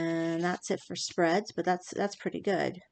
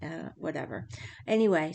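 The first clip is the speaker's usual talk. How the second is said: ordinary delivery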